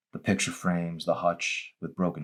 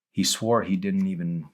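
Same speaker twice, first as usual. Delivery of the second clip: ordinary delivery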